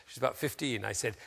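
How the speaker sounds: ordinary talk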